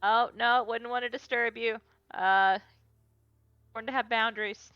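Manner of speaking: sweetly polite. Acted. Asher feigns polite reassurance.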